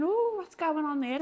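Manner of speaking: high, slow and wondering; puzzled wonder. Retold or imitated. Host watching the friend's eyes clear, slow puzzled wonder. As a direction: draw out the puzzlement.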